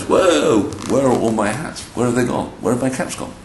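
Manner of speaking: high-pitched startled questions; surprised cap seller. Acted. Cap seller wakes to find his caps gone, surprised. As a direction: wake up to find them missing, surprised. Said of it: "to his surprise".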